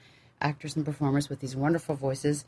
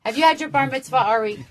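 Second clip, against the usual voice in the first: much higher, cooing puppy voice; 8 semitones higher, 5 dB louder than usual. Real, not acted. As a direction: gush over the puppy sweetly.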